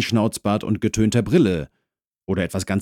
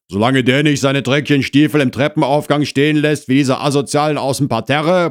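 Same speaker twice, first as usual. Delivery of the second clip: loud, quick, higher grumble; grumbling; 7 semitones higher, 7 dB louder, faster than usual. Acted. Husband complains about boots in the stairwell, grumbling. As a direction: grouse loudly from indoors. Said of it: "brummte der Mann".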